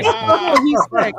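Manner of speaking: a loud, high burst of delight; loud amused exclamation. Real, not acted. Friend shouting with delight at the husband's joke.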